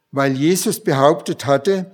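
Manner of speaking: ordinary delivery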